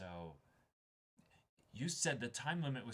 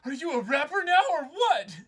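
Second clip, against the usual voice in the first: mock outburst; 14 semitones higher, 14 dB louder than usual. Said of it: "She's like".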